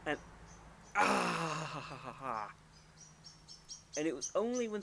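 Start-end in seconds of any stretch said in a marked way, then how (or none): 1.0-2.5 mock anguished cry. Player recalling the dreaded puzzle, mock anguish. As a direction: burst out, then tail off in breathy ha's.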